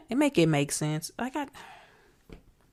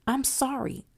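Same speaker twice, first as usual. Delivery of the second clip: normal talking voice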